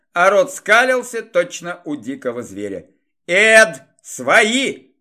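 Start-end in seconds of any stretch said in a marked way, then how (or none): 3.3-4.9 loud urgent cry; exclaiming. Acted. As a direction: call out sharply from the floor. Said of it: "воскликнул я с пола".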